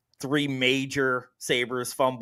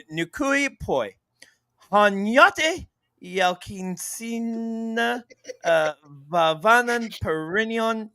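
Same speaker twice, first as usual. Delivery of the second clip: mock Finnish accent; 3 semitones higher, slower than usual. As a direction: ham up Finnish names with too much excitement. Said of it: "Finnish accent all week".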